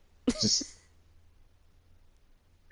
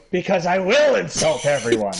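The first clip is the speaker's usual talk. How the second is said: New York Jersey accent; 6 semitones higher, 5 dB louder than usual. Shown as a demonstration. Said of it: "I can say this in".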